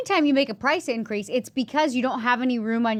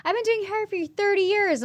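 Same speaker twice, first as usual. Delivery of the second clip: high-pitched mock hairdresser voice; mocking boast; 10 semitones higher than usual. Hairdresser bragging about long experience, mocking high voice. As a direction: parody a self-important veteran, pitched way up.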